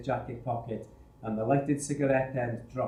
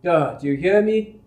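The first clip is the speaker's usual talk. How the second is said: loud, higher, furious yelling; livid shout; 5 semitones higher, 7 dB louder than usual. Acted. Charge hand yelling at the three, livid shout. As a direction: threaten them like you mean every word. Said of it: "He was livid".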